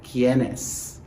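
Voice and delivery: normal talking voice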